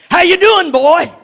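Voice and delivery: booming high greeting; big man greeting a scared boy loudly. Retold or imitated. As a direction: boom it out like a mean man. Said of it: "didn't have to say it so loud".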